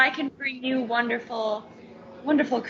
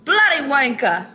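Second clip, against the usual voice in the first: a loud, hammed-up British swear; mock British insult; 6 dB louder than usual. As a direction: bark it in a bad British accent. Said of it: "There you go".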